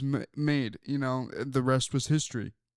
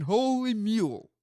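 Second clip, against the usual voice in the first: higher, stretched mock announcer call; a lightly voiced announcer pun; mock catchphrase; 6 semitones higher than usual. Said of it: "How about saying".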